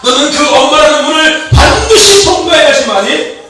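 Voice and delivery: loud, driving sermon delivery; full-force preacher calling out; forceful preaching. Acted. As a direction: preach it out to the whole room. Preaching to the congregation.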